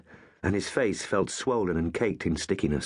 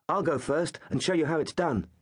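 cheerful; 8 semitones higher than usual. Acted. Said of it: "Gaius had said cheerfully".

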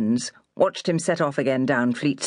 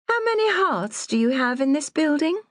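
higher, lofty prying lady; haughty lady voice; 11 semitones higher than usual. A narrator's reading. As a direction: ask like a condescending snob.

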